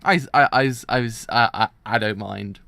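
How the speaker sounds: loud, stuttering nervous hero; mock flustered shouting stammer; stammering MC impression. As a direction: blurt the I's out loud and flustered. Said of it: "first impression of the M C is kinda like".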